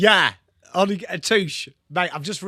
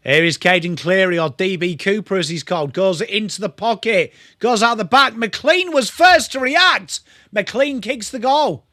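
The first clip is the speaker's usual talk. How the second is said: louder, building higher to the goal; excited goal call; 5 semitones higher, 7 dB louder than usual. Acted. Streamer calls a McLean goal for chat, building excited commentary. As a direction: call it like the crowd is on its feet.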